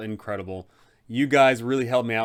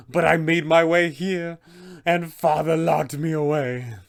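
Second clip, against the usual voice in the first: vampire spawn's confession; 4 semitones higher, 4 dB louder than usual. Acted. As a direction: confess the story to the party, haunted and pained. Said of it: "tell them the whole story".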